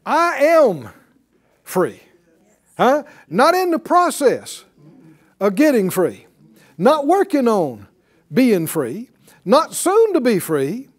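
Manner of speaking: high, punched preacher's phrases; forceful declarations with ringing key words; emphatic preaching. Real, not acted. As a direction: drive each phrase home to the congregation.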